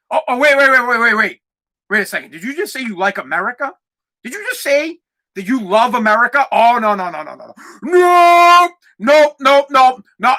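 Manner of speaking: mock panic. Mocking the league's horrified reaction to loving America. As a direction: fake a meltdown over loving America.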